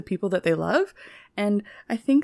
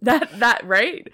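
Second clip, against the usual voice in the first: ordinary talk, nothing marked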